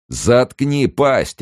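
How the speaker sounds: loud, high, drawn-out bellow; gendarme's bark. A narrator's reading. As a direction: bark her into silence, stretching it slowly. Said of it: "рявкнул жандарм".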